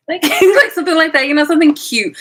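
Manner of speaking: high, loud, giggly delivery; laughing excitement. Real, not acted. Vlogger laughing about posting cute pics. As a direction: laugh through the words.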